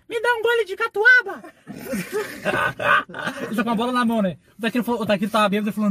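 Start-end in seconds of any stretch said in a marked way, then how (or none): 0.1-1.4 fininha e bem aguda, de criança pedindo; voz de criança imitada. Retold or imitated. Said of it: "Ela chega assim pro Takiro". 1.7-3.4 risada aguda. Real, not acted. Risada após imitar criança.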